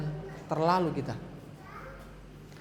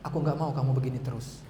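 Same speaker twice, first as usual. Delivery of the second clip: softer, tender fatherly plea; God's loving voice; 5 semitones lower, 6 dB quieter than usual. Acted. God pleading with a sinner, tender and soft. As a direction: gently call the straying child home. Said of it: "Dia bilang begini".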